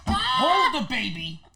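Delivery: loud, high instructions in mimicry; Rick impression. Star handing baby to youngster while Dexter smokes, impression. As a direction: boss the youngster through it step by step. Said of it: "That's him".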